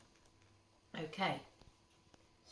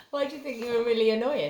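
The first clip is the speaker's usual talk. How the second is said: teasing; 6 semitones higher, 12 dB louder than usual. Acted. Parent teasing child.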